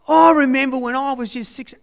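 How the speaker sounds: put-on high, lively voice of an old stroke patient; imitating an older man beginning a memory. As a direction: put on the old man's cheery start. Impersonating stroke patient mid-story.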